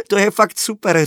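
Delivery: put-on high, loud, delighted girl; excited little girl; laughing delight. A narrator's reading. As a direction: burst out with it, tickled. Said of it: "Sky se začala smát".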